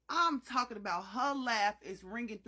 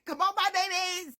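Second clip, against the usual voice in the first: excited call; 7 semitones higher, 6 dB louder than usual. Real, not acted. Calling viewers.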